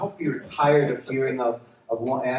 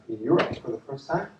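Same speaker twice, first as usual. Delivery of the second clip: ordinary talk, nothing marked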